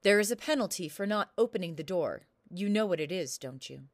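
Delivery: loud, stern king demanding; a booming ruler laying down the law. Acted. King threatens the storyteller.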